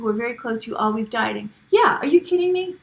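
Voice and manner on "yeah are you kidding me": incredulous. Real, not acted. Reacting to question about dieting family.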